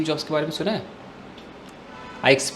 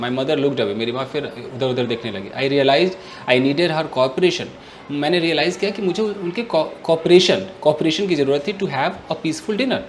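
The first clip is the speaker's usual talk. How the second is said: plain reading voice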